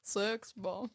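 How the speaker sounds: soft, half-hearted Welsh crooner; Welsh accent. Acted. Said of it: "trying to do a [name]".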